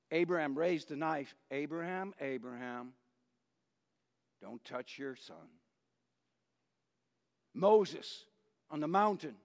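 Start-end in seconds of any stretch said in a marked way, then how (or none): 1.5-5.6 soft, low, hushed and tender, fading quieter to the end; intimate calling. Retold or imitated. God stopping Abraham's knife, softly and tenderly. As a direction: call his name gently, as if right beside him. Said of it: "it's very intimate".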